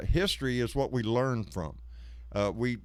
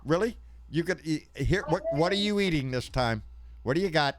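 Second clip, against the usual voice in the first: playful mock outrage, higher; teasing disbelief; 6 semitones higher, 4 dB louder than usual. Real, not acted. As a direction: tease them about eating again. Teasing about eating again.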